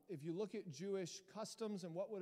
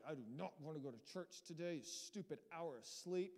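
grumpy; 3 semitones lower, 6 dB quieter than usual. Retold or imitated. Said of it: "grumpy mood and just like".

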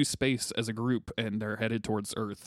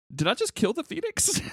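high giddy question; 14 semitones higher, 3 dB louder than usual. Retold or imitated. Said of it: "Tony Stark is like".

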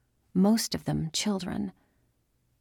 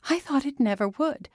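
near tears; 7 semitones higher than usual. A narrator's reading. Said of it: "She started crying".

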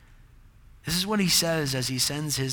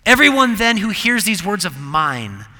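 louder first reading of the verse; ringing proclamation; 3 semitones higher, 7 dB louder than usual. Shown as a demonstration. Repeating the verse two ways.

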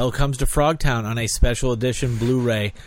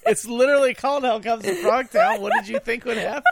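laughing disbelief; 10 semitones higher than usual. Real, not acted. Laughing incredulous retort.

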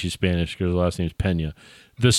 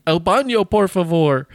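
mock Spanish accent; 8 semitones higher than usual. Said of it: "then she goes".

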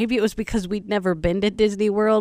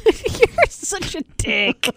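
high, drawn-out through laughter; real amused laugh in the words; laughing insult; 10 semitones higher, much slower than usual. Real, not acted. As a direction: crack up while teasing him affectionately. Laughing at his dumb guy voice.

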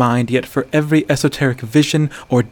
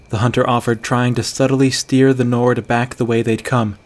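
ordinary delivery

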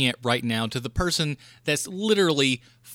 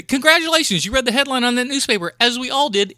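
sarcastic rant; 7 semitones higher, 5 dB louder than usual. Real, not acted. Ranting at screen readers.

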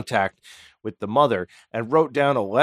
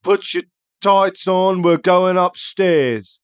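higher, louder, slow goofy Houdini; lightly hammed Houdini addressing his dead mother; mock Houdini voice; 5 semitones higher, 4 dB louder than usual. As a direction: play him jaunty and slow.

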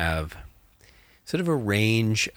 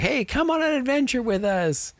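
much higher, bright invitation to adventure; eager party member; 3 dB louder than usual. Retold or imitated. Players inviting the star bartender, mock eager and higher. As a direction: play the party begging the NPC along. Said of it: "the party's like".

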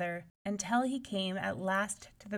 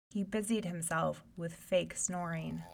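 plain reading voice

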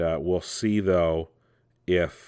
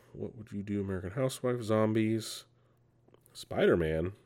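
much softer, half to himself; quiet reading aside; 7 dB quieter than usual. Real, not acted. Host reads an actress's credits to himself, murmured. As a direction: mumble the list as you scroll.

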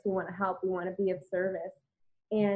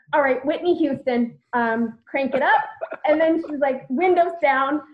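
playful hype; 5 semitones higher, 6 dB louder than usual. Retold or imitated. Heather cranking music.

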